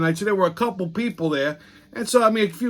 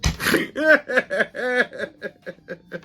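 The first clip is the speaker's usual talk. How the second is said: long hearty laugh; 7 semitones higher than usual. Real, not acted. Laughing at own story.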